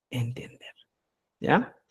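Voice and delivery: whispered aside. Real, not acted. Teacher to students on spelling, whispered. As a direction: drop to a whisper on entender.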